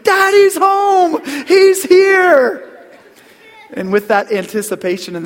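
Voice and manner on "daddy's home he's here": very high, loud, drawn-out excited shout; dog screaming with joy. Pretend. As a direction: scream it like an overjoyed dog at the door. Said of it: "he would be screaming out".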